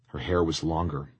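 ordinary delivery

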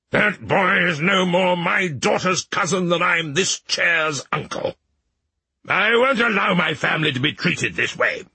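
mild royal fury, dragged out; fuming king. A narrator's reading. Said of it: "the king fumed".

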